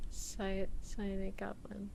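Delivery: whispered "psionic goblin". Shown as a demonstration. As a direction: breathe it to yourself.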